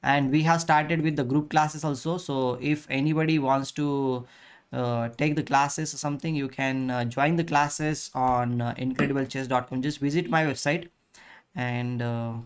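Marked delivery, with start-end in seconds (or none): none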